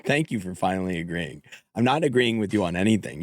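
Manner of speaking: ordinary delivery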